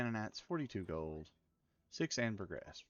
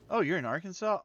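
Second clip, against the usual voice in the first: surprised; 8 semitones higher, 10 dB louder than usual. Real, not acted. Streamer reading chat, surprised a viewer is in Arkansas. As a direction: pitch jumps high, much louder, delighted surprise.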